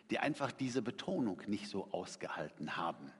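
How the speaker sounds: ordinary talk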